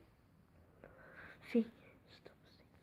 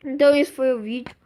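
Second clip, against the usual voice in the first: quick sign-off; 12 dB louder, faster than usual. Real, not acted. Encerra o vídeo.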